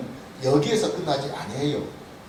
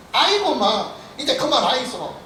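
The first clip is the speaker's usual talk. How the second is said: put-on higher, louder Gyeongsang accent, fed up; Gyeongsang dialect; 8 semitones higher, 4 dB louder than usual. Preacher voices the grumbling Israelites, irritated Gyeongsang dialect. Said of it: "경상도 버전으로 합니다".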